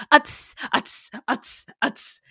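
imitating awkward uh and tss sounds; a hammed-up stutter of filler noises; repeated uh and tongue-click tss; mock hesitation noises. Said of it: "chock full of, uh, tss".

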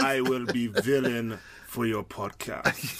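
put-on slow, soft Russian villain accent. Co-host plays the podcast villain in a slow Russian accent. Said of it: "that was a really great Russian accent".

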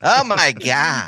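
put-on slow, camp valley-girl voice; camp American accent. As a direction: drawl it like LA. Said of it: "American in this way".

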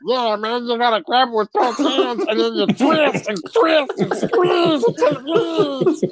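high-pitched imitation, laughter creeping in; overheard man. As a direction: act out the overheard voice, stretching the ending high. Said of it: "I heard something in there going".

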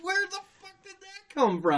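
high laughing voice. Retold or imitated. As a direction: laugh out the question. Retold confusion, laughing.

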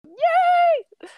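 a high, loud, joyful yay; excited cheer. Real, not acted. Host cheering the podcast's one-year anniversary, excited cheer. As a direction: cheer the milestone at the top of your voice.

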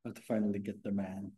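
low, soft-spoken delivery, barely projecting; too quiet. Real, not acted. Guest boyfriend offering the episode title to the host, too quiet. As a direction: say it softly, under normal volume. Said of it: "have to speak louder though".

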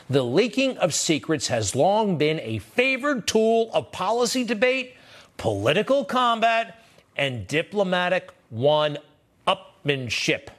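slow, high, deliberate read-aloud; emphatic article reading. Acted. Host reading a Times paragraph to listeners, pointedly emphatic. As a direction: spell out each phrase slowly for effect.

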